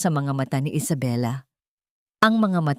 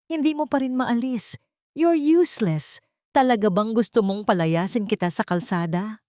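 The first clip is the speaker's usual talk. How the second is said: put-on higher, sneering scold of an old servant; cruel scolding; 6 semitones higher than usual. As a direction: belittle her, rising to a contemptuous peak on useless. Diana berates old maid.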